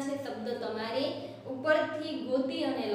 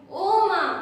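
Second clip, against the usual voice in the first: startled cry to mother, higher and louder; scared cry; 5 semitones higher, 8 dB louder, much slower than usual. Acted. Teacher acting a fearful kid crying out, startled. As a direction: cry out for mom like a jumpy kid. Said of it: "તેને તો પાંદડું હલે તોય બીક લાગે".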